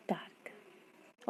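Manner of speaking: breathy whisper of dark, almost no voice; soft whisper to the class; whispered word. Shown as a demonstration. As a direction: whisper the word dark very softly. Spelling then saying the word.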